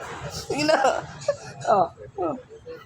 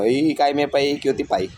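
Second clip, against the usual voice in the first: normal talking voice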